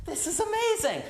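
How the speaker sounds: can't believe our luck; thrilled. Retold or imitated.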